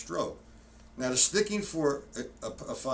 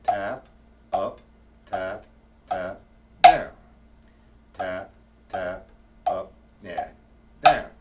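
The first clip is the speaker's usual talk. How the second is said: slow stroke calls; 4 semitones lower, 9 dB louder, slower than usual. Shown as a demonstration. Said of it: "the five-stroke roll, slow".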